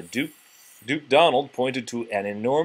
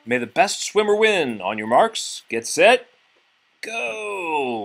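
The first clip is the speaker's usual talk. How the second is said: louder, higher starting call; race starter call; 6 semitones higher, 4 dB louder, slower than usual. A narrator's reading. Sir Goofy starting the swim race, loud starter call.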